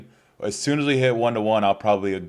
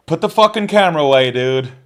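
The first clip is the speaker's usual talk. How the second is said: angry shout; 7 semitones higher, 9 dB louder than usual. Real, not acted. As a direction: yell it, much higher and louder. Yelling at someone filming.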